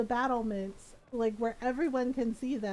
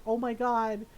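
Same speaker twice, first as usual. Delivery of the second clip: louder, higher excited mock panic with laughs; breathless tattling, half laughing; gossiping messenger; 5 semitones higher, 4 dB louder than usual. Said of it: "He's like".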